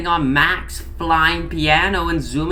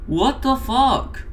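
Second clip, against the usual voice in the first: slow shocked professor; 7 semitones higher, slower than usual. Acted. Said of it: "screamed the fucking stats professor".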